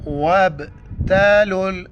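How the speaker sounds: وَابْتَلُوا with the ب bounced, a jolt on the stopped ba; qalqala bounce. Shown as a demonstration. Teacher shows qalqala on وَابْتَلُوا, jolted ب. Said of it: "میں نے جھٹکے کے ساتھ پڑھا".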